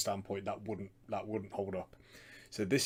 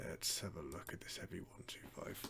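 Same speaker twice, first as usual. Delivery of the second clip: whispered to himself; breathy muttered aside; whispering; 2 semitones lower, 11 dB quieter than usual. Real, not acted. Muttering while scrolling to video.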